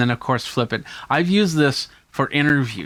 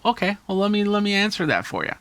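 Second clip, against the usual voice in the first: higher, quick obliging voice; mock guest reply; 7 semitones higher, faster than usual. Guest voiced obligingly answering the host, lightly higher. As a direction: play the guest taking the question. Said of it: "And then you go".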